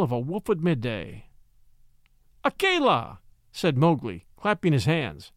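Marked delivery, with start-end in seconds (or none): 2.4-3.2 high, delighted call; joyful cry of a name. A narrator's reading. Mowgli greeting the wolf Akela with a joyful high call. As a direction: greet an old friend gladly.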